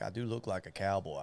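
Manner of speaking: usual speaking voice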